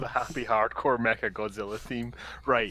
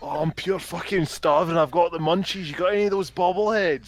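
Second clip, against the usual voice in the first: put-on Glasgow street accent; 4 semitones higher, 4 dB louder than usual. Continuing Ned voice.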